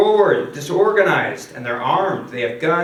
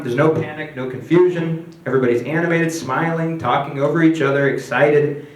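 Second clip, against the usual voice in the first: ordinary delivery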